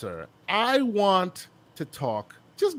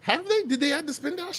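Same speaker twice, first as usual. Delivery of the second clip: surprised; 11 semitones higher, 4 dB quieter than usual. Real, not acted. Surprised reaction.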